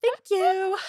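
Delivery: high, loud fake thank-you; hammed-up sarcastic gratitude; mock gushing thanks. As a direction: pretend to be thrilled by the compliment. Acts fake reply to a catcaller.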